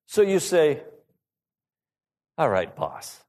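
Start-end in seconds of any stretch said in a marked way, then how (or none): 2.4-3.2 low, resigned mock agreement; grudging compliance. Giving in to somebody bossy, grudging. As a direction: give in reluctantly, voice dropped low. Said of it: "somebody who gets bossy, that you don't want to do".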